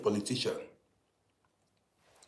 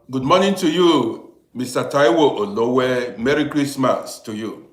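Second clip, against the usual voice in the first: loud greeting; 8 dB louder than usual. Real, not acted. Greeting a viewer by name on his live show, loud call-out. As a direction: hail the viewer loudly by name.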